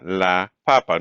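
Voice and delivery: "papa" with the first pa stressed; teacher's model of "la papa", stress before the last vowel; stress on first syllable. Shown as a demonstration. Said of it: "Notice no accents".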